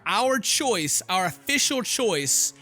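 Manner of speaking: usual speaking voice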